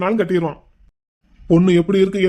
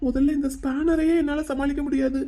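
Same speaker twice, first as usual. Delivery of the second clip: high, softened nervous fretting; flustered; 6 semitones higher, 4 dB quieter than usual. Acted. Young man dreads brother finding his visitor, flustered. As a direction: worry aloud, half to himself. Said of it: "நீ ஏன் இப்ப இவ்வளவு பதற".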